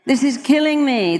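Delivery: hammed-up moaning; mock melodrama. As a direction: overplay the whiny exaggeration. Mocking dramatic complainers.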